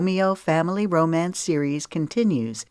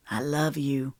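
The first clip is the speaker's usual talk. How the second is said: earnest; 2 semitones lower, 5 dB quieter than usual. A narrator's reading. Groom's earnest declaration.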